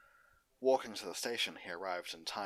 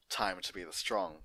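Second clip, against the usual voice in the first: ordinary delivery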